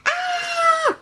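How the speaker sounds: high-pitched cry of alarm; sudden high yelp; yell. A narrator's reading. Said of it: "Jack yelled".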